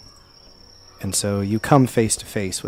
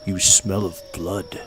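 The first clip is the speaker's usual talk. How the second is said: slow, lightly voiced creature speaking broken Common; halting words in a foreign tongue; slower than usual. Creature sniffing out a stranger, slow broken Common. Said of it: "says to you in very broken common".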